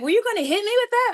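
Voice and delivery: very high incredulous question; amused disbelief. Retold or imitated.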